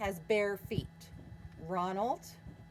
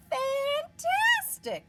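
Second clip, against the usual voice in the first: much higher, loud and drawn out; excited praise; 8 dB louder, much slower than usual. Acted. Princess cheering the dragon on, high and drawn out. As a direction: gush with wonder.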